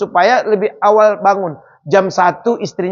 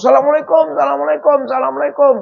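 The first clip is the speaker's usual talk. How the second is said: calling at the door; 6 semitones higher, faster than usual. Retold or imitated.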